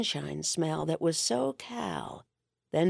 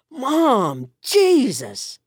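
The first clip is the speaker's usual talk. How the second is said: louder, higher, mortified; mortified outburst; 5 semitones higher, 5 dB louder, much slower than usual. Acted. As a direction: cry out in embarrassment at his mother. Son mortified by his mother.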